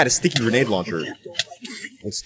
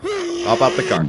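high, drawn-out plea. Real, not acted. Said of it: "Just hop out the car".

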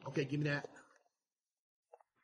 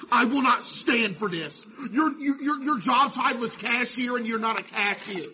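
mock scolding; 4 semitones higher, 6 dB louder than usual. Scolding the lazy employee Tannehill.

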